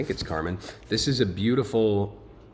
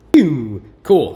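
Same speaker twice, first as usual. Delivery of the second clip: pew sound effect; 5 semitones higher, 12 dB louder than usual. Pretend. Sound effect for skipping ahead.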